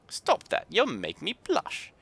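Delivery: a cross Yorkshire artificer grumbling; Yorkshire grumble. Acted. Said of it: "in a thick Yorkshire accent".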